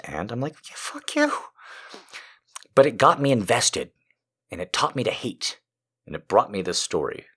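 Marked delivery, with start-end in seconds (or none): 0.7-1.3 very high, soft mock comeback; comic falsetto-like brush-off; mock retort. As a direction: squeak out a dismissive reply in a put-on high voice. Acted retort to Italian pizza expert.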